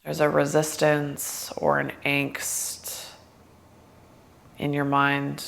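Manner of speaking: guided meditation. Acted. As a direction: ease them gently into stillness.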